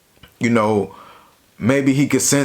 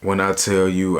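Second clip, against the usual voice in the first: ordinary delivery, nothing marked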